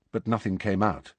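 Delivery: usual speaking voice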